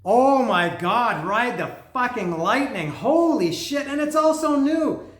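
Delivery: overwhelmed by the find; giddy shouts of delight; thrilled. Real, not acted. Fan discovers a sealed record.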